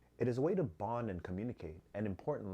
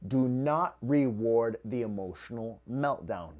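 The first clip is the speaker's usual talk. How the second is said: slow and louder, NOT punched; slow, loud emphasis; 7 dB louder, slower than usual. Shown as a demonstration. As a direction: hammer the rule home slowly. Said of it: "this is very important".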